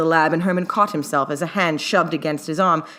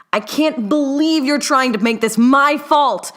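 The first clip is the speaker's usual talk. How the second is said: high, slow, loud shout; 10 semitones higher, 6 dB louder, slower than usual. A narrator's reading. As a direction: hurl it across the room. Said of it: "Newt shouted".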